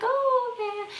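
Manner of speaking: high then dropping soft, uneasy mock politeness; awkward okay. Woman listening to the stranger's cancer story, awkward polite okay. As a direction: humour him uncomfortably. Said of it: "I'm like".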